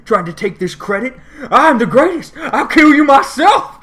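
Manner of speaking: rising to a high, loud furious screech; building dragon rage. Retold or imitated. Nicol Bolas accusing his returning brother, building to a furious screech. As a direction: build from hurt to a screaming threat.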